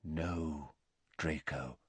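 a gentle, hushed correction; quiet. Acted. Dumbledore to Malfoy, answering quietly and calmly. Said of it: "said Dumbledore quietly".

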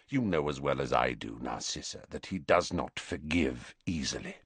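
low, hushed and quick; soft warning. A narrator's reading. Snape warning Narcissa, hushed. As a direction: drop the voice to a murmur.

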